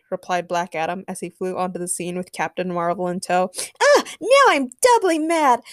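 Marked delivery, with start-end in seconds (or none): 3.8-5.6 high, loud, exasperated outburst; angry shout. A narrator's reading. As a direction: shout as rage boils over. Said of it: "Supergirl shouted".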